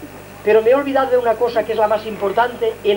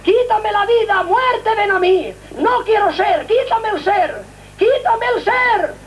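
higher, slow anguished pleading for death; desperate cries; 8 semitones higher, slower than usual. Retold or imitated. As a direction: wail it out in despair, mock-retold. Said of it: "gritaba, a gritos terribles".

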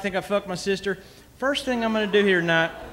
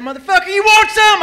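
loud, much higher tough-guy challenge; hammed-up retold threat, squaring up; mock fighting yell; 12 semitones higher, 10 dB louder than usual. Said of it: "I said".

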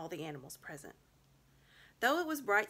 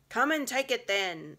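loud, high bull's bellow; bull-man defying the witch; 6 semitones higher, 11 dB louder than usual. A narrator's reading. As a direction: roar the challenge. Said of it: "in a great bellowing voice".

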